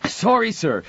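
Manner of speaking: high, loud panting alarm; breathless urgent cry. A narrator's reading. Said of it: "the young man cried, chest heaving".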